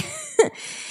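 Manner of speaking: squeaky little laugh; nervous laugh. Real, not acted. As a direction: trail into a high awkward giggle. Laughs after awkward refusal.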